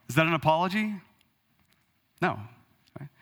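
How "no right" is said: usual speaking voice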